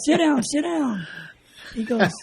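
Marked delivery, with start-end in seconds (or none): 0.0-1.1 high, drawn-out, slow insistence; eager urging. Retold or imitated. Officer to the recruit he was sending away, excitedly insistent. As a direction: imitate a starstruck man urging someone to stay. Officer thrilled, urges him to stay.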